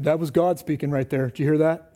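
a hint of a booming spirit voice; mock deep holy voice. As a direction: play at being a deep-voiced prophet. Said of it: "my voice gets real deep".

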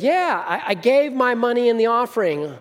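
put-on a seven-year-old boasting to the preacher's wife; little girl. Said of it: "Little girl said".

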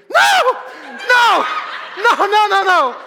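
high, very loud panicked scream. Retold or imitated. Preacher reliving his panic on the pole, screaming. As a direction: scream it out in full-throated refusal. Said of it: "I just scream".

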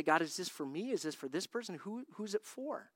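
hushed prayerful asking; quiet prayer. Retold or imitated. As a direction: murmur the question to God.

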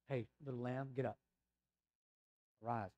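soft and hushed, leaning close; gentle whisper. Retold or imitated. Jesus waking the little girl, soft hushed voice. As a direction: murmur it tenderly into a child's ear. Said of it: "This all-powerful God whispers".

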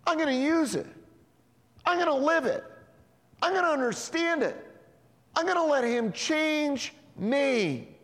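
fervent, preached vows, slowing at the end; building commitment. Acted. Preacher voicing believer's commitment.

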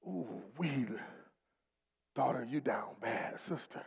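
soft, low, near-whispered comfort; tender hush. Acted. Jesus leaning close to the grieving woman, soft and low. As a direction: murmur to her as if at her side.

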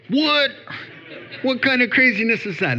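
high, incredulous what-kind-of-craziness; mock outrage. Preacher mocking the world's outrage at believers, high incredulous. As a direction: put on disbelief, as if shocked.

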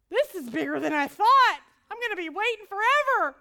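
loud, high exasperated wail; comic frustrated loud complaint; exasperated outburst. Retold or imitated. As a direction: throw up your hands and complain loudly. Exasperated reaction.

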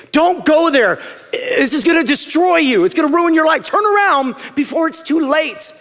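high, loud, pleading warning; impassioned warning call; urgent loving warning. Acted. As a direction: cry out to save them from danger. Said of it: "the way it sounds is everything".

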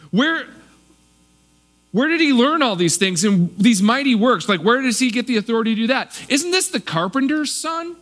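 rising to a high, scornful isn't this the carpenter's son; indignant questions piling up, pitch climbing; offended hometown crowd. Retold or imitated. Offended locals belittling the carpenter's son, high scorn. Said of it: "They just, like".

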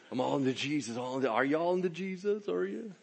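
high, giddy mimicking voice; mock a gushing new believer, climbing in pitch; teasing squeaky-high enthusiasm; over-keen convert.

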